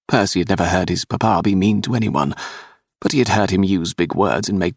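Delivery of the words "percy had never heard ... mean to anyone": ordinary delivery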